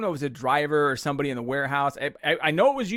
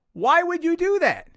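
ordinary delivery

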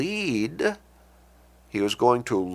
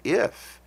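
"if" lifted high on its own; stress on IF; 8 semitones higher than usual. Real, not acted. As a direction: set "if" apart with a raised pitch. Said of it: "I emphasize that word".

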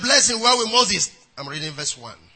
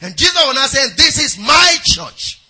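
preaching surge; 3 semitones higher, 4 dB louder than usual. Acted. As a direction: proclaim it over the congregation. Preaching at full force.

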